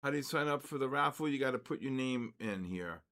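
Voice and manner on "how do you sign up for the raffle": ordinary delivery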